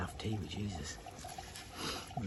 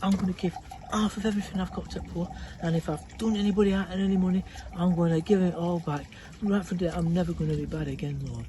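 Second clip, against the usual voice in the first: earnest vow; 7 semitones higher, 9 dB louder, faster than usual. Acted. Zacchaeus repents, vows.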